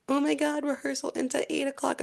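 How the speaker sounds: high, whiny mock complaint; mocking classmates. Mocking her schoolmates' complaint in a high whiny voice. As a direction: squeak it like a spoiled classmate. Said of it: "they're like".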